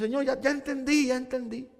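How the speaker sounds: pleading to God. Retold or imitated. Preacher telling God he has learned, mild pleading. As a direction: beg the Lord to stop, a little childlike. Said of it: "Yo digo".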